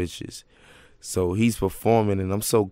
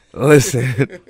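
louder, laugh breaking in; laughing retold plea; 3 semitones higher, 9 dB louder than usual. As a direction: push it out through a laugh. Retold request, cracking up.

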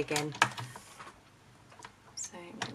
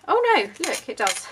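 surprised; 7 semitones higher, 7 dB louder than usual. Real, not acted. Surprised it works.

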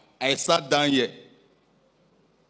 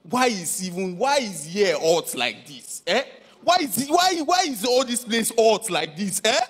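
angry customer; 12 semitones higher than usual. Acted. Complaining loudly in a restaurant.

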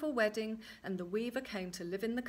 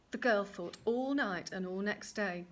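usual speaking voice

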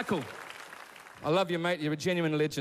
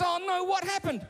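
higher, urgent acted dad; pressing dad; 13 semitones higher than usual. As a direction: press for the story as an anxious father, pitch climbing. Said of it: "And Mike goes".

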